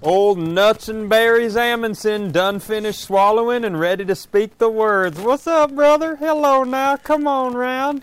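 put-on high hillbilly accent, drawled. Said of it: "your hillbilly voice".

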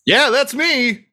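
high, slow and showy; milk the recognition grandly; stretched-out, high-pitched celebrity reply; self-important gnome. Acted. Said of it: "She says, uh".

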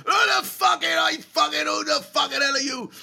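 high, full-throated sweary yell; shouting bloke. Retold or imitated. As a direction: bellow it like a man shouting at the room. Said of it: "there was a bloke just shouting".

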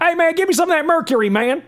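high, fast Southern yokel send-up; mock southern accent. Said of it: "How many scientists out there go".

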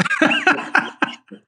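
high, breathy burst of laughter; sudden high laugh. Real, not acted. Fighter laughing about a man he loves, high burst of laughter.

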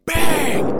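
a sudden shouted bang noise, high and loud; imitated loud bang. Storyteller mimicking the crash that woke him, loud bang noise. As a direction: blurt out a big crash sound. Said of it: "there's this loud".

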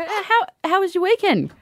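high, polite question; bright small talk to a colleague; mock cheery asking; chirpy question. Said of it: "I go".

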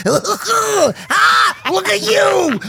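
high, loud excited little brother. Retold or imitated. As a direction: shout like the kid brother. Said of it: "I become the little brother".

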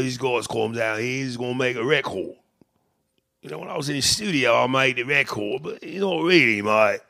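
put-on hard Cockney patter; rough-and-ready mock Cockney; hard Cockney accent. Shown as a demonstration. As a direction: imitate a thick Cockney bloke. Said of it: "Cockney was kinda like that really hard".

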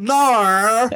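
high, playful "Nar" for no; mock Aussie nar. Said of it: "Australians that they say "ner".

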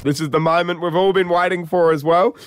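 normal talking voice

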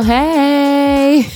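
normal talking voice

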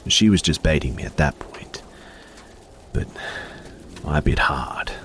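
plain reading voice

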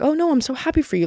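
high, quick imitation of insincere congratulations; mock a friend's falsely pleased reply; fake happy. Imitating the friend lying about the relationship, fake happy. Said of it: "like, lying and being like".

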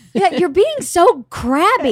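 put-on high, drawn-out kid's complaint; complaining child. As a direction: mimic a child griping at mom.

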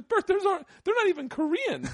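high-pitched comic indignation; retold exasperation. Friend correcting comic about strangers, retold exasperation. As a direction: imitate an appalled friend, voice shooting up.

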